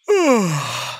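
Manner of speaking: long yawn. Real, not acted. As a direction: give in to another yawn. Talking about why we yawn.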